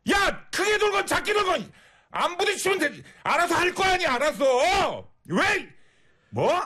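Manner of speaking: high shouting rant at a rude driver; angry on request. Pretend. Scolding a rude male driver on request, furious shouting. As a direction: tear into him, yelling. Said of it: "화 좀 내주세요".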